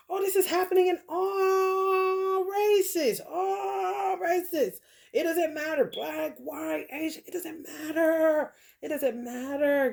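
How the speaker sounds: high, slow, near-crying exasperation; high pained it doesn't matter; exasperated rant. Real, not acted. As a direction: plead it out in disbelief. Emotional high-pitched rant.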